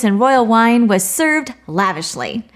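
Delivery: usual speaking voice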